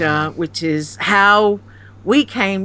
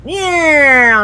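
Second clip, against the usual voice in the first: loud; 8 semitones higher, 7 dB louder than usual. Retold or imitated. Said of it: "And I went".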